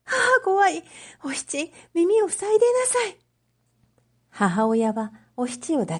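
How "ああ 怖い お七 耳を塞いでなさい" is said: put-on high, fearful voice of an older woman; a mother scared of the thunder clutching her daughter; frightened mother. Mother to Oshichi during the storm: high frightened plea.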